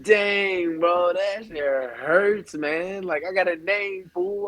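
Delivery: high-pitched, drawn-out wounded complaint; mock-hurt protest. Unknown player reacting to fans' snub, mock-hurt.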